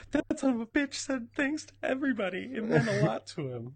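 high, mocking sarcastic friend voice; mock Facebook friend. Mocking the cohost's birthday thank-you post, high sarcastic voice. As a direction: squeak it out as a snide Facebook friend. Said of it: "They're gonna be like".

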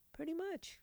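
high, soft sarcastic aside; mock agreement. Radio host mocking a listener's theory, sarcastic soft voice. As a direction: toss it off in a mock little voice.

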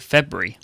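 FEBRUARY shortened to "FEB-ree"; letters cut out. Shown as a demonstration. Briton explains saving letters, FEBRUARY cut to "Feb-ree". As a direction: drop the middle of FEBRUARY, leaving "Feb-ree". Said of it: "cut out a bunch of letters".

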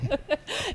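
a bright little laugh; short high laugh. Real, not acted. Laughing at own answer.

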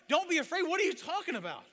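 high, loud outburst of disbelief; frantic, indignant servant shouting; incredulous shout. Acted. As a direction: yell it back as if it's absurd. Servant throws the words back, incredulous.

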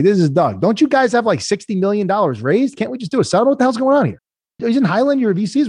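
high, incredulous mock executive voice; exasperated executive. Mocking the label CEO on the call, high and incredulous. Mimicking CEO's exasperated question.

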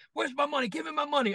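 high-pitched mock confrontation; acted demand, high without shouting; retold demand. Goulet confronting the eating kid about his money, mock demand.

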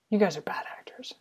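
whispered aside. Real, not acted. Muttered judgment at screen.

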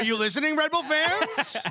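taunting call-out. Acted. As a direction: needle the Red Bull fans, stretching it out. Calling out rival fans.

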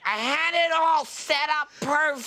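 high, plaintive mimicked whine; mock wailing complaint. Imitating her over the wrecked pillow stack, mock wail. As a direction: whine it high as if all is ruined. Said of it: "It's more like".

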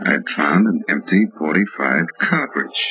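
low, steady hardboiled storytelling; detective narration. A narrator's reading. Detective narrating his case to the listener, low and steady. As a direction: tell it like a tired private eye looking back.

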